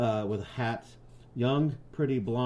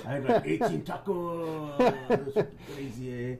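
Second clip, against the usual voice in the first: imitating coworker; 2 semitones higher than usual. Host imitates a coworker bragging about a dream. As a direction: put on a bro coworker telling his dream. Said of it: "your coworker's like".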